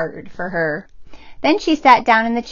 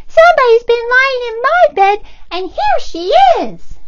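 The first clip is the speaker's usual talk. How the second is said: very high, loud squeaky cub; tiny bear crying out in alarm; little bear's cry; 9 dB louder than usual. Acted. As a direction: shout out in shock at the girl. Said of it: "But then he cried".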